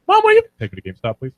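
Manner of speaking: put-on whiny kid voice, very high and fast; childish high squeak. Teen imitating his old squeaky voice begging mom. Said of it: "I was like".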